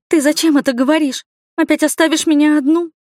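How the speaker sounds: high, upset questioning; distressed protest. A narrator's reading. Heroine to her departing sister, distressed high protest. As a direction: plead in alarm against being abandoned. Heroine fears being left alone again.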